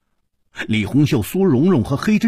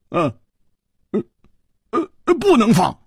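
stammer, then burst out high; hesitant start, sudden high-pitched refusal; halting refusal; 11 semitones higher, faster than usual. A narrator's reading. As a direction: hesitate on grunts, then blurt it out. Halting refusal in acted dialogue.

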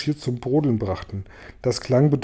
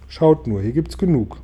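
plain reading voice

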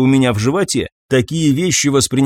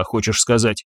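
ordinary delivery

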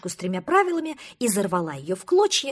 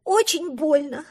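higher, slow blubbering; 6 semitones higher, 6 dB louder than usual. Acted. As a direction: whimper through tears. Duckling still crying.

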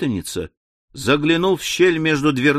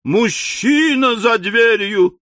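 high, drawn-out cry of alarm; horrified wail; 7 semitones higher, 3 dB louder, slower than usual. A narrator's reading. Miss Smithers finds a man behind the door, wailing. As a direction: wail it slowly in hysterical fright. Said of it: "возопила мисс Смитерс".

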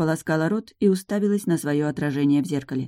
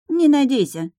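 snapped, short and higher; curt cut-off; 9 semitones higher, 5 dB louder than usual. A narrator's reading. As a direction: cut her off flat. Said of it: "отрезал Трикс".

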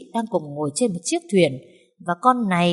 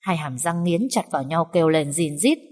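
usual speaking voice

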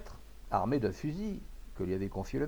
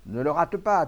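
loud, high urging; excited onlooker's raised voice; calling out; 5 semitones higher, 8 dB louder than usual. A narrator's reading. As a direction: shout advice from the crowd. Another onlooker calls to shooter.

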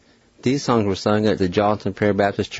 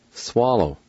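ordinary talk, nothing marked

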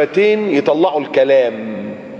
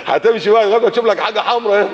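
higher voice breaking into chuckles; amused, ending in a giggle; laughing; 6 semitones higher than usual. Real, not acted. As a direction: laugh through the joke. Joking about seeking something red.

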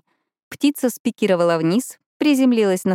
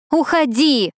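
high, loud yell at the bird; shouting; 8 semitones higher, 3 dB louder, slower than usual. Acted. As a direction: shoo it away desperately. Shouting at the magpie.

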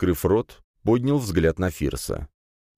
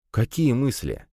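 higher, lightly voiced young man; uneasy question; 7 semitones higher, slower than usual. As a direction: ask it warily, pitched up. Young man questions a threatening boss. (настороженный вопрос парня)

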